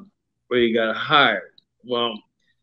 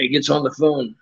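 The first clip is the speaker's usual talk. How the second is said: ordinary talk, nothing marked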